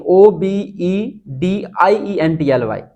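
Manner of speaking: letters spelled out slowly one by one; slow spelling. Shown as a demonstration. Teacher slowly spelling out obediently for the class. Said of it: "yours obediently".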